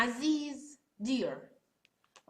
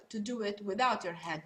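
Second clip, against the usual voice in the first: ordinary talk, nothing marked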